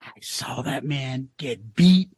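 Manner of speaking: low, slow movie trainer; hushed boxing-manager impression; Apollo Creed's manager. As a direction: recall it gravely, low and slow. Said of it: "Apollo Creed's manager saying".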